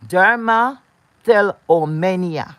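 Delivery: Dermatillomania said slowly, syllable by syllable; slow, careful. Shown as a demonstration. Introducing the viewers to a medical term, said slowly. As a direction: spell out Dermatillomania slowly and clearly.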